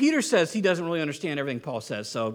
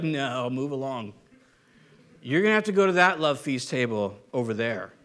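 dismissive snub; 7 semitones lower than usual. Acted. Peter turning someone away from his table, dismissive. Plays Peter snubbing a churchgoer.

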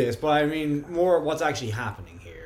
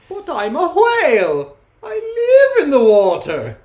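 high, loud, slow whale voice; slow and booming like a whale; 12 semitones higher, 9 dB louder, slower than usual. Acted. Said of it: "do the whale voice".

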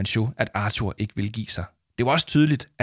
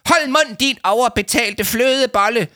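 much higher, loud insulting yell; furious shout; 13 semitones higher, 5 dB louder than usual. A narrator's reading. Adviser yelling at the host, furious shout. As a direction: scream the insult. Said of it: "råbte Leo Manchot til Hugo Bæk".

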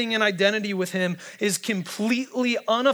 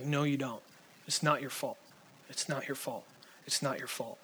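fading softer with each repeat; gentle insistence; 4 semitones lower, 9 dB quieter than usual. Retold or imitated. Counselor to the kid, growing ever softer. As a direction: press it home, quieter every time. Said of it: "He's like".

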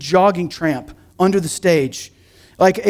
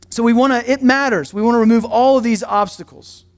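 ordinary talk

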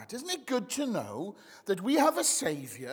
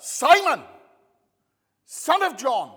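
loud, raised attention-grabbing call; 5 semitones higher, 11 dB louder than usual. Acted. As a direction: summon him sharply by his full name. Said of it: "And so the call goes up".

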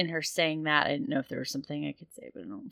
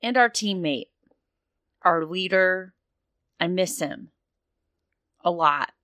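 louder and higher, grief pushing through; tearful, rising protest; 4 semitones higher, 8 dB louder than usual. Acted. Sure Shot answering her teammate, loud and tearful. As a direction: insist on what he meant to her, near tears. Grieving character answers back.

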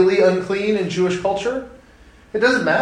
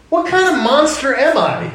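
much higher, louder, stunned exasperation; incredulous; 9 semitones higher, 4 dB louder than usual. Real, not acted. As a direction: throw up your hands in disbelief. Exasperated dad reacts.

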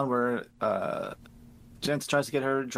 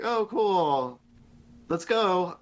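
higher, lightly imitated glee; mock cheerfulness; 5 semitones higher, much slower than usual. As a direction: imitate the wife's breezy acceptance. Mimics wife's glib acceptance.

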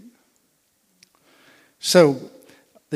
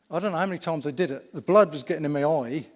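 ordinary talk

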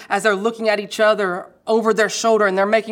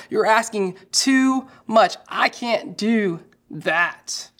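slow complaining; slower than usual. Retold or imitated. Crowd complaining teaching too hard.

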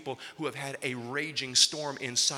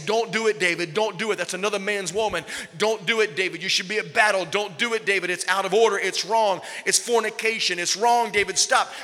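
louder, higher urgent warning; God's warning voice; 6 semitones higher, 8 dB louder than usual. Acted. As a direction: plead and warn, hammering don't. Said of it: "He heard God's voice say".

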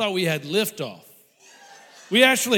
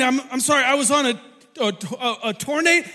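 ordinary talk

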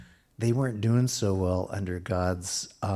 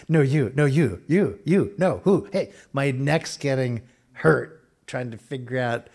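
louder, higher mock argument; playful back-and-forth; 5 semitones higher, 6 dB louder than usual. Preacher acting out two givers refusing each other, playful and loud. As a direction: bounce between two givers, laughing.

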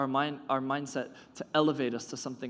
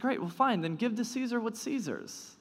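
dismissive; 9 semitones higher than usual. Retold or imitated. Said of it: "almost frustrated".

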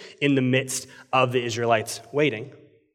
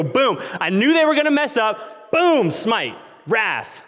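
high, punchy outburst of pretend smiting; hammed-up gotcha, pitched up; mock divine wrath; 7 semitones higher, slower than usual. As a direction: burst out triumphantly, striking each blow. Acting out God waiting to smite.

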